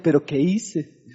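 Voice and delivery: low, soft, anxious muttering to himself; worried husband. Retold or imitated. Husband worried after his wife's cold greeting, anxious. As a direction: fret under your breath about what you did. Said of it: "comienza uno a analizar".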